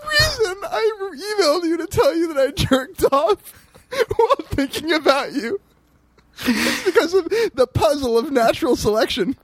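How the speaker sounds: very high, squeaky laughing voice; helpless laughter. Real, not acted. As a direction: force it out while cracking up. Cracking up paraphrasing the excuse.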